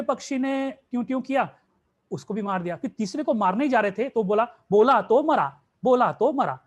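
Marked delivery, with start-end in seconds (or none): 4.7-6.6 loud warning call. Acted. Prince calls out warning the bird.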